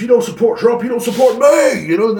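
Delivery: climbing to a high, loud yell; mock outraged shout. Mimicking how people act nowadays, rising to outraged shouting. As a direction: build into an indignant scream on the last words. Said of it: "People are acting like".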